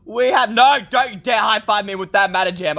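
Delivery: normal talking voice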